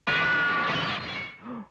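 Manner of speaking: sharp high gasp of alarm; startled gasp. Acted. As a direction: catch your breath at the crash. Hears a crash outside.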